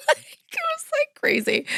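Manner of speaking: squeaky high laugh on the words; real giggles breaking the words. Real, not acted. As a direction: laugh your way through it. Laughing about the chaos.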